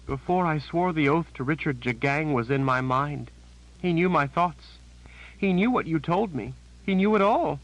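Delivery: high, shaken voice of a terrified sister; a scared woman blurting out bad news; frightened Janet. A narrator's reading. Janet voiced, building fear.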